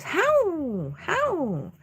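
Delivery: high howling dog calls; mock dog howl, pitched high; imitated dog howl. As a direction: howl like a husky talking. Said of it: "And it was".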